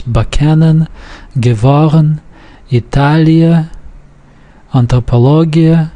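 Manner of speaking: Bakanen, Gewaren, Italia, Anthropologia stressed second syllable from last; penultimate stress. Shown as a demonstration. Teacher reading unmarked words from the textbook, penultimate stress. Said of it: "stress falls on the second".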